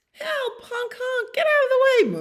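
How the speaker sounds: very high, squealed cries; frantic high-pitched mock panic; panicked crowd. Panicked street with honking cars.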